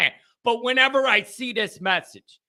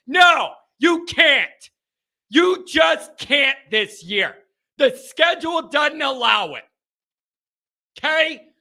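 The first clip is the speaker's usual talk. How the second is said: emphatic insistence; 5 semitones higher than usual. Real, not acted. Host lecturing fans on the schedule, emphatic. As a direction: hammer the point home.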